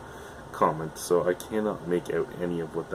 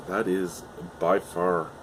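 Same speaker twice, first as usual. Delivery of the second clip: ordinary delivery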